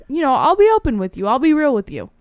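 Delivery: usual speaking voice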